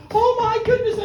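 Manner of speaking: put-on boy thrilled by the rubbish valley; delighted exclamation. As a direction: squeal it out.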